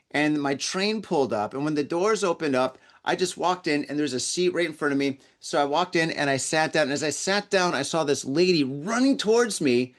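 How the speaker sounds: ordinary talk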